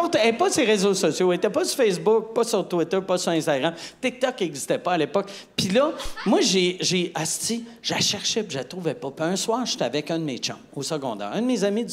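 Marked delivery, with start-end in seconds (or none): none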